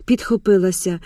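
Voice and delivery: usual speaking voice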